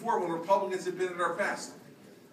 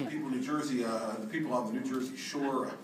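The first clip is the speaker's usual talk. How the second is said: stiff, formal official announcement, a bit softer; pompous governor reading a warning to the shore; mock gubernatorial; 6 semitones lower, 4 dB quieter than usual. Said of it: "I tried to act very gubernatorial".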